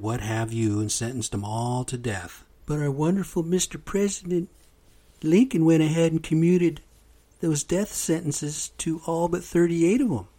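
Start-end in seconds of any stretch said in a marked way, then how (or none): 2.7-10.3 higher, mocking "wonderful Mister President"; sarcastic praise. Real, not acted. Speaker mocking President Lincoln to listeners, sarcastic. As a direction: sneer out the praise.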